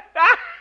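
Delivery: a sudden high squeal of a laugh; delighted yelp of laughter; high giggle. Acted. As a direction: giggle sharply at the joke. Giggles at the joke.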